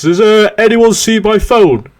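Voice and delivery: high, slow clueless question; mock innocent ask. Guardsman asking the lads, high and slow. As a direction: play him lost and innocent. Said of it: "he comes down saying".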